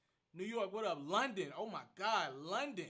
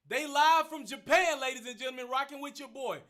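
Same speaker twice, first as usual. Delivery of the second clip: hype announcer; 6 semitones higher, 10 dB louder than usual. Pretend. Host shouting out Japan viewers to the live audience, playful hype announcer.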